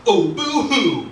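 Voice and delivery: high, loud, drawn-out mock crying; mocking boo-hoo. Jack mocking the monk's upset, taunting. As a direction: exaggerate the fake sympathy.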